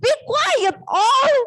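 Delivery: very high, playful angry yell; acted scolding. Big mouse angrily hushes the owl, high mock yell. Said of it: "terus marah-marah ya".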